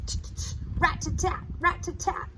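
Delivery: rapid rat-tat-tat tapping; woodpecker tapping. Shown as a demonstration. Teacher showing woodpecker sound, quick tapping. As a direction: tap it out like a beak on wood. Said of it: "When a woodpecker hits the tree, it goes".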